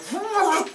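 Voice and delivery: high wordless mock sputter; imitated spit-take. Reviewer recalling chugging water while watching, imitated spit-take. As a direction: act out choking on a drink.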